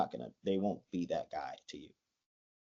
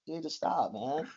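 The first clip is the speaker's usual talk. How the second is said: higher, louder hammed-up bro confrontation; mock tough guy; 11 semitones higher, 4 dB louder than usual. Teasing teammate confronts a friend over a joke, hammed-up bro swagger. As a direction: puff up like a teammate picking a fake fight. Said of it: "I'll be like".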